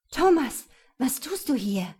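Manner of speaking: startled, panicked gasp of his name; frightened. Acted. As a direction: caught off guard and scared. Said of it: "Sabines Kehle war wie zugeschnürt".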